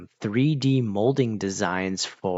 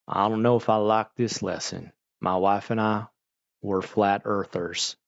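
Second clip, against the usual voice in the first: imitated country-accented parent, slowing at the end; deadpan country accent objection. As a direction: put on a mild country accent. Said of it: "I always do the country accent".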